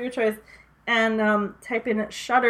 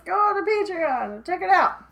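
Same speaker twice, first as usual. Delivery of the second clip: voicing Gus; 6 semitones higher than usual. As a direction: pitch it up for a quoted plug. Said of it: "he says".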